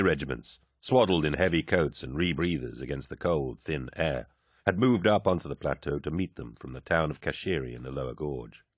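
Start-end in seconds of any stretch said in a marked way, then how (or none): none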